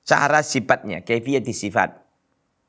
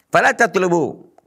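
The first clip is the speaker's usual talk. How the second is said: higher, slow and louder chanted Arabic; emphatic recitation; 9 semitones higher, 5 dB louder, slower than usual. Shown as a demonstration. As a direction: intone it as a solemn warning. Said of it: "recites Arabic phrase emphatically".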